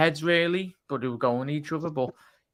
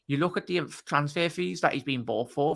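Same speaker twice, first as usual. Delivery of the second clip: normal talking voice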